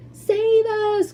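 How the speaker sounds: put-on high, loud, shrieking old lady; terrified old woman crying for help; old woman's cry. A narrator's reading. Grandma Josephine in bed crying out in terror. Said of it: "cried Grandma Josephine".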